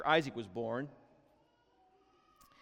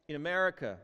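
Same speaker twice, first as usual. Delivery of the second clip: normal talking voice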